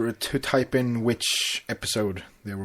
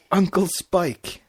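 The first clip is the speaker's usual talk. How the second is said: Spike musing; 7 semitones higher, 5 dB louder, slower than usual. Acted. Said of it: "Spike mused".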